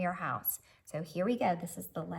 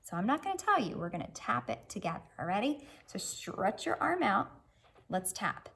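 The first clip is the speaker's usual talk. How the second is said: ordinary delivery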